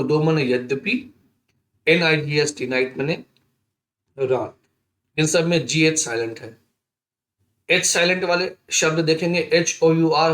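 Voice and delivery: usual speaking voice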